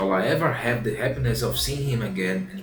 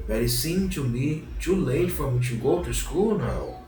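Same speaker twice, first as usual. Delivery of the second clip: a high, lightly moaned puppet whine; puppet moaning; 5 semitones higher than usual.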